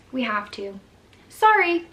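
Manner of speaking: a snippy, throwaway "Sorry" tossed over the shoulder; mock nurse brushing off the worried mom; curt brush-off. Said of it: "that's literally how she said it".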